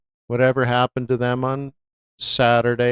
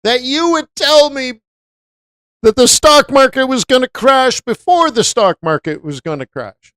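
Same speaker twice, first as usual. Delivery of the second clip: imitating an upset friend; 8 semitones higher, 4 dB louder than usual. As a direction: whine it like an accusing buddy. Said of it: "they're like".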